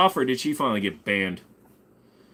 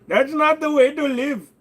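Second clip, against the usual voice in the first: scolding put-on voice; 5 dB louder than usual. Same scolding voice.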